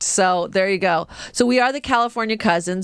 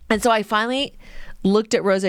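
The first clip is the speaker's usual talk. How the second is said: ordinary talk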